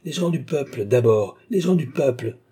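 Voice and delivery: high, emphatic proclamation; proclaiming. A narrator's reading. Surgeon stating his maxim to admirers, proclaiming. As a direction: proclaim it loudly to the crowd.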